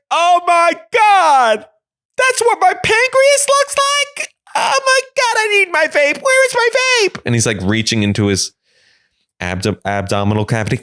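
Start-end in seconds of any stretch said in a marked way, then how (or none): 0.1-7.2 high panicked patient. Retold or imitated. Patient waking mid-surgery and seeing his pancreas, high and panicked. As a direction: freak out at your own insides.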